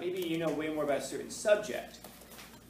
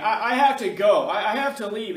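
1 mock panic; 7 semitones higher, 13 dB louder than usual. Panicking student repeats himself.

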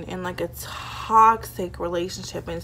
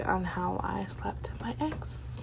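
whispering; 12 dB quieter, slower than usual. Real, not acted. Coy whispered confession.

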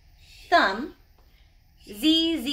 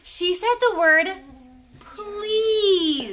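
excited gasp; 7 semitones higher, 3 dB louder, much slower than usual. Real, not acted. Teacher thrilled at shy child.

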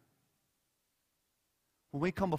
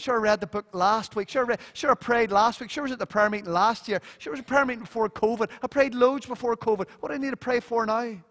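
breezy, higher, louder brush-off; complacent; 6 semitones higher, 5 dB louder than usual. Retold or imitated. Preacher mocking complacent churchgoers, breezy and higher. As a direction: toss it off like a churchgoer who's done enough. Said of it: "We're complacent".

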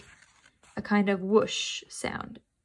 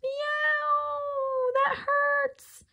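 much higher, crying cat shriek; pained screaming cry; much slower than usual. A narrator's reading. Toby bitten by the dog, crying out shrieking. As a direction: wail in pain and panic. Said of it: "Toby screamed".

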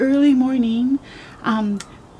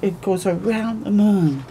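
plain reading voice

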